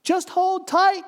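high, loud call up; yelled. Acted. Dangling stranger yells up to the man on the bridge. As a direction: shout up to hold on. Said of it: "yelled the one dangling off the bridge".

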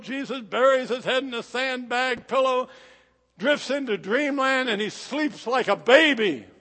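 ordinary delivery

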